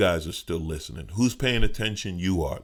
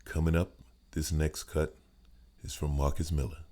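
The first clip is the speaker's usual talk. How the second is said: silky jazz station announcer; smooth jazz radio voice; 2 semitones lower, 5 dB quieter than usual. Shown as a demonstration. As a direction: croon it like a jazz radio host. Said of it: "you got that smooth jazz voice".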